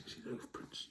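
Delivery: soft whispered question to listeners; whispered aside. Real, not acted. Asking children about the picture, whisper. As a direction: lean in and whisper.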